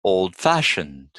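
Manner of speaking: put-on old-fashioned with FASH stressed; old-fashioned, weight on the second part. Shown as a demonstration. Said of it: "stress the second part".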